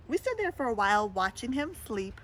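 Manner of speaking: plain reading voice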